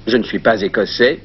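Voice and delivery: usual speaking voice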